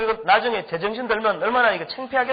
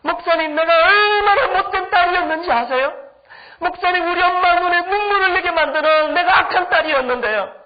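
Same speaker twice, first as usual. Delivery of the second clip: high, loud mock-sobbing confession; imitated tearful wailing; 8 semitones higher, 4 dB louder than usual. Schoolgirl confessing to her pastor she was a bad daughter, imitated wailing. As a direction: wail it out like a weeping schoolgirl. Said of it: "퍼질지 앉아서 우는 거예요".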